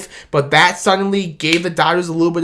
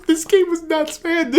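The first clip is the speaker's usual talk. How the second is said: much higher, breathy laughing words; laughing disbelief; 11 semitones higher than usual. Real, not acted. Fan recalling a crazy Game Seven: laughing. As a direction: laugh through it in amazement.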